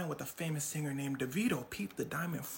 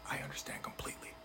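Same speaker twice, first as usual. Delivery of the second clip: whispered lie; 4 semitones lower, 11 dB quieter, faster than usual. Retold or imitated. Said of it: "his partner goes".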